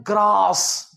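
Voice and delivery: GRASS as "graas"; British-Indian pronunciation. Shown as a demonstration. Teacher showing how we say it, "graas" ग्रास. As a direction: say ग्रास with long open aa. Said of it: "हम जिसे बोलते हैं".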